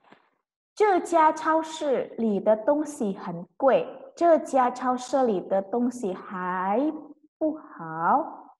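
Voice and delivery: very slow, each syllable clear; slow model reading. Shown as a demonstration. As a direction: read it slowly for students. Teacher reads model sentence. (membaca sangat pelan)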